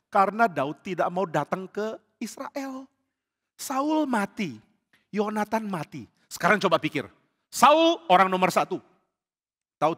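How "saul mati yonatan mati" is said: normal talking voice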